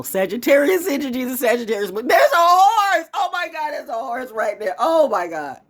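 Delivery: high, loud cry of delight; excited outburst. Real, not acted. Spotting a horse arriving while talking, thrilled shout. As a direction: erupt with thrilled amazement.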